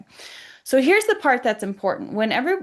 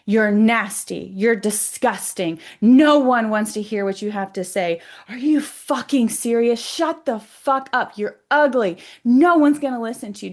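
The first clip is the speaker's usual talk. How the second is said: nasty vitriol; 6 dB louder than usual. Retold or imitated. Hostile energy berating her with insults, nasty and hateful. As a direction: spit out hateful insults, louder and emphatic. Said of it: "the worst things come out of".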